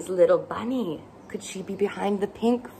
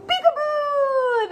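very high, slow sing-song peekaboo; playful peekaboo; slower than usual. Acted. Host lifting the laundry flap for kids, slow high peekaboo. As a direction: draw it out like a peekaboo game. Peekaboo reveal in kids' book.

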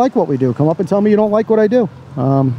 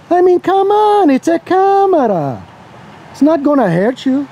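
exasperated; 7 semitones higher, slower than usual. Real, not acted. Exasperated at camera-shy people.

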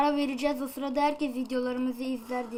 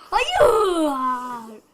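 loud, shocked cry of no; sudden no; 8 dB louder than usual. Real, not acted. Gamer reacting to the game, a loud shocked no. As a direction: blurt it out, caught off guard.

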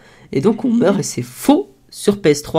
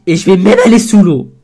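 put-on drunk voice; 4 semitones higher, 8 dB louder than usual. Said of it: "je sais pas imiter le bourré".

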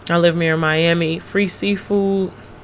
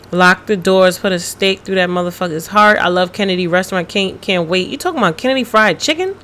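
usual speaking voice

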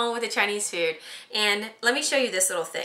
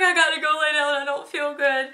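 higher, whiny sick complaint; a queasy moan, retreating to bed; feeling sick; 7 semitones higher, 4 dB louder than usual. Retold or imitated. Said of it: "And I'm like".

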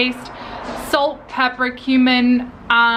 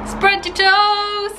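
high, slow, drawn-out mock command; playful echo; 9 semitones higher, much slower than usual. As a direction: stretch it out high, teasing. Playfully echoing partner's command.

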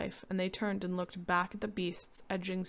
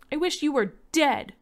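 high, loud furious scream; boy screaming at his father in rage; 8 semitones higher, 9 dB louder than usual. Acted. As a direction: hurl it at him.

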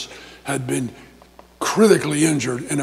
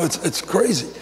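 normal talking voice

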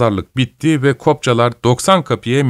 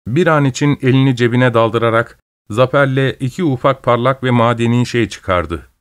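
plain reading voice

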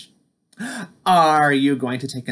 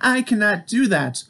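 shouting; 9 semitones higher, 3 dB louder than usual. Acted. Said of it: "shouted Toad".

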